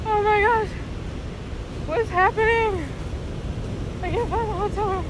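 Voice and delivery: panicked story clip, lost and scared; real panic, very high and strained; freaking out. Real, not acted. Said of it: "I'm freaking out right now".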